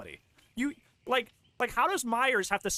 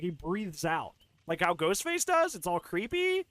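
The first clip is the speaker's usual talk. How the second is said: ordinary delivery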